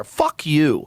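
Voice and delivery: normal talking voice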